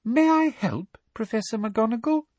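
poisonously sweet, high voice; Professor Umbridge. A narrator's reading. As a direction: offer help with syrupy malice. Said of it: "in her most poisonously sweet voice".

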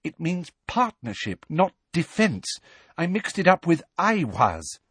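agitated schoolgirl; Hermione upset. A narrator's reading.

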